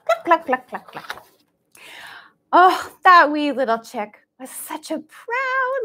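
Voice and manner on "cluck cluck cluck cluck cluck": hen clucks. Acted. Hen sound for kids.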